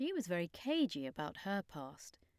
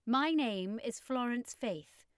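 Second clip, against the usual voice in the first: slow, upper-class English lady; posh refined voice; 5 semitones higher, 4 dB louder, slower than usual. A narrator's reading. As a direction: speak like a refined aristocrat. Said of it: "in an extremely posh, refined voice".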